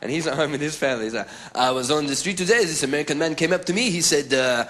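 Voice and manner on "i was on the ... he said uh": put-on Parisian telling a story in accented English; mock Frenchman storyteller. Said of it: "there's a guy in Paris right now".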